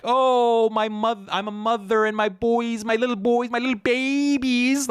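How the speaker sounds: high, mock-tearful mother voice, pleading; mock sentimental mother, stretched out on babies; mocking weepy mother. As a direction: ham up a sobbing mom begging about her babies. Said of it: "She appealed to".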